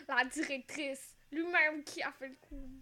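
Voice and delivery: loud, drawn-out dramatic reveal; hammed-up whodunit reveal; mock dramatic accusation. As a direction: announce the culprit slowly and loudly.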